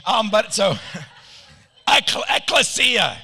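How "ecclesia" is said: ECCLESIA as ek-luh-SEE-uh, pitched higher; decisive ecclesia, stressed SEE; chosen pronunciation. Shown as a demonstration. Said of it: "that's what I'm going with".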